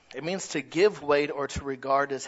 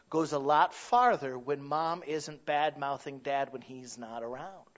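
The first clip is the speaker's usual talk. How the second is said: normal talking voice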